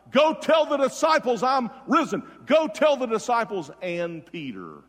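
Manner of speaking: loud, high preaching cry; triumphant proclamation. Retold or imitated. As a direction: proclaim it at full force, settling slightly later. Emphatic repeat of risen Jesus' message.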